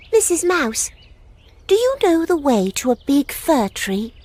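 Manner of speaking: high little bunny voice; a small lost rabbit asking politely, pitched high; timid young rabbit pitched well up; Peter Rabbit asking. Acted. Peter asks the mouse.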